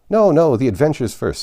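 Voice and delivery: loud, high and impatient, cutting her off. A narrator's reading. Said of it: "in an impatient tone".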